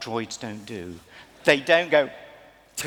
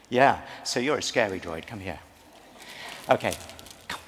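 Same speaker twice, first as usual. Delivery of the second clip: ordinary delivery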